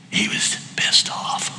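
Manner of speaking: hushed, slow whisper for emphasis; whispered aside. Acted. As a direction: lean in and whisper it like a secret. Preacher drops to a hiss.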